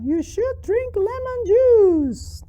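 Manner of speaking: very high, slow puppet voice; drawn-out squeaky character answer. Acted. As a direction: pipe up as an eager little puppet. Puppet Charlie answers the teacher.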